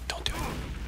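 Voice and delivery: hushed, voiceless aside; very soft whisper; whispered warning. Real, not acted.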